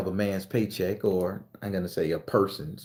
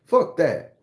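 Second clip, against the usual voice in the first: ordinary delivery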